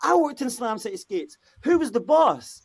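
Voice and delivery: high, bright mock astonishment, pitched well up; imitating her excitement. Woman at party recognising the skate store, mock excited high voice. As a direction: play the stunned fan who worked there too. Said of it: "She's like".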